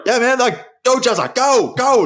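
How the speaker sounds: high, excited mock yell; imitated cheering. Supporter cheering Jezza on in the marathon, excited yell. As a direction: urge a runner on from the sidelines. Retelling himself cheering the runner.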